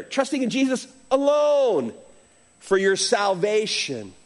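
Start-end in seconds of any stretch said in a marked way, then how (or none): 0.0-1.9 "alone" stretched out with a final fall; raised pitch, lingering on "alone"; stress on ALONE. Real, not acted. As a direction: make "alone" the big word. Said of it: "It's a big word there".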